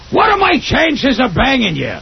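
high, slow comic-impression voice, pitched well up; Gilbert Gottfried voice. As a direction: drag out the pickup line in a high imitated voice. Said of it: "that's a great Gilbert Gottfried impression".

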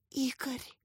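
very soft, frightened whisper of a name. A narrator's reading. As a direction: barely breathe the name out. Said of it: "прошептала Катя". (испуганным шёпотом)

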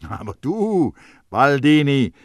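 emphatic rallying of oneself; stern self-address. A narrator's reading. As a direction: call yourself to order. Baldini sternly addresses himself.

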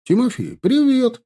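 high cheerful call from horseback. A narrator's reading. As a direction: hail a friend across the street. Rider calls out a greeting.

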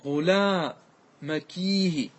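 "وغلامكيه" very slow, in pieces, long kee then hi; lamenting ya ending. Shown as a demonstration. Teacher building the feminine lament, drawn-out ya ending on "وغلامكيه". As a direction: break up "وغلامكيه" and stretch the ee after kaf. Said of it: "فاجعل الياء بعد، بعد الكاف".

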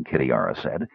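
ordinary delivery

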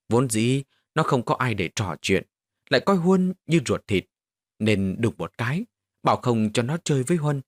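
ordinary delivery

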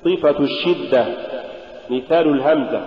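normal talking voice

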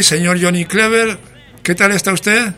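ordinary talk

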